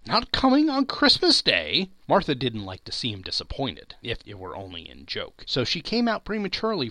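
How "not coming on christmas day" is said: high, louder dismayed question; dismayed disbelief. A narrator's reading. Father dismayed his daughter is absent, high shocked question. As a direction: let his spirits sink in disbelief.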